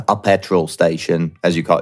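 lightly attempted British accent; terrible British accent. Borrowing the host's word petrol station, bad British accent. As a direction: slip into a poor British accent. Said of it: "that was a terrible accent".

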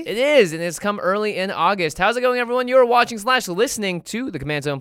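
loud, high hyped show opener; excited. Real, not acted. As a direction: kick off the show with energy.

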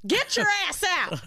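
mock yell. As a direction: shout him away like Angela. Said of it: "She's like".

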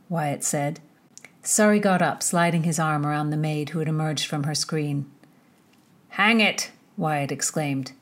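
measured audiobook reading voice; story narration. A narrator's reading. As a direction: read the chapter like a storyteller. Reads the novel chapter aloud.

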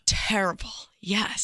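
hushed, breathy whisper; soft whispered yes; whispered verdict. Real, not acted. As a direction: whisper it like a confession. Hushed verdict on sad movie.

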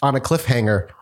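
usual speaking voice

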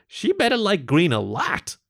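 high, slow, drawn-out quip; voiced shopkeeper character. A narrator's reading. Mo warning the customer about green, drawn-out character voice. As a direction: stretch it out high with emphasis.

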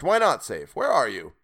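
loud astonished questioning. A narrator's reading. Sailor calling after the silent stranger, astonished. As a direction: press him, astonished. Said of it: "growing more and more astonished".

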